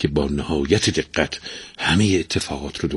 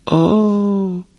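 long, high-pitched parrot sigh; high squawky "aah" of a sigh; parrot's sigh; 12 semitones higher, 4 dB louder, much slower than usual. A narrator's reading. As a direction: heave a drawn-out sigh in a parrot voice. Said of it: "آهی کشید و گفت".

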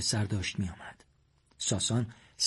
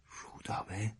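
whispered; 3 semitones lower, 15 dB quieter, slower than usual. A narrator's reading. Sohrab's stunned one-word question.

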